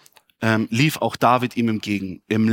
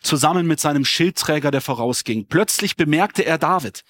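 normal talking voice

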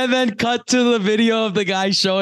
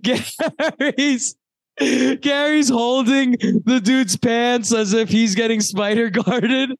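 excited retelling; 7 semitones higher than usual. Real, not acted. Excitedly retelling Gary's blunder.

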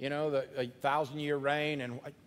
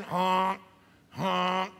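goose honk; 2 semitones higher, 5 dB louder than usual. Retold or imitated. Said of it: "what these geese are saying".

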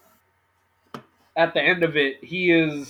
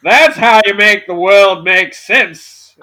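slow, loud, higher Southern drawl; Southern lawyer voice; 5 semitones higher, 12 dB louder, slower than usual. Acted. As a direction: play it like a hammy small-town Southern lawyer. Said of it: "a Southern lawyer".

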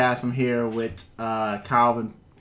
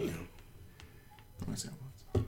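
whisper; 17 dB quieter, much slower than usual. Real, not acted. Whispered aside.

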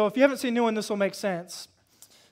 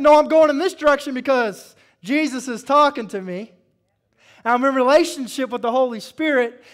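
loud, high defiance at the devil; defiant shout; 6 semitones higher, 11 dB louder than usual. Pretend. Believer shouting down the devil, loud and defiant. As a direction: tell the devil no with full force. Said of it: "and say".

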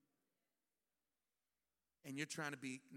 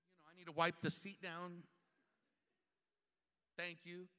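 passive-aggressive politeness; 5 dB louder than usual. Acted. Martha passive-aggressive to Mary.

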